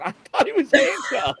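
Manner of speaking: laughing it out, high-pitched; giggling through the words; amused laughing disbelief. Real, not acted. Laughing at the handcuff mix-up.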